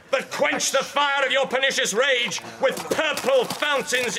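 high, loud royal decree; commanding prince. Acted. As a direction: thunder over the crowd. Prince stops the riot.

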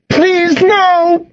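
high, pleading wail. A narrator's reading. Friend begging as the coyote attacks, wailing. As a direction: wail it as a desperate plea. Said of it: "Cassidy wailed".

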